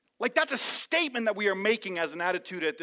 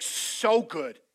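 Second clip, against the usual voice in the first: enthusiastic; 3 dB louder than usual. Retold or imitated. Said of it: "the word Jesus chose".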